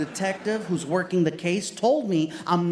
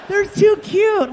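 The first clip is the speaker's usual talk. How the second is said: gushing barista; 13 semitones higher, 7 dB louder, slower than usual. Retold or imitated.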